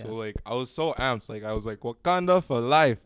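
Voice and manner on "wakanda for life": slow mock Wakandan battle cry; imitated Wakandan accent. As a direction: draw it out in a put-on Wakandan accent. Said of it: "I can make an accent that sounds like Chadwick".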